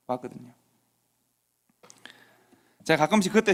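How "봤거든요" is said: 봤거든요 repeated much softer; soft echo. Shown as a demonstration. Speaker echoing that everyone saw the dare, 봤거든요 repeated quietly.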